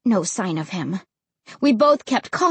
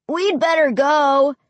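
slow, raised call from a distance; drawn-out, higher-pitched call; 4 semitones higher, 4 dB louder than usual. A narrator's reading. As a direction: call it across the cemetery. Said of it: "Ray called".

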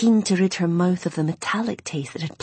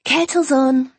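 higher, louder call from afar; calling back; 9 semitones higher, 7 dB louder, slower than usual. Acted. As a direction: answer from the kitchen. Mum calling back from kitchen.

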